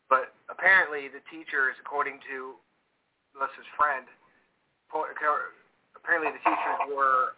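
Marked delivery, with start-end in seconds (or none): none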